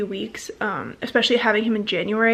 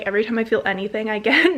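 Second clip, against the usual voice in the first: ordinary talk, nothing marked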